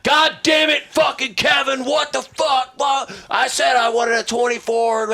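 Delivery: high, loud furious director rant; imitated exasperated director yelling; megaphone scream. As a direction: scream it at the crew through a megaphone. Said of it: "he's screaming through the megaphone and shit".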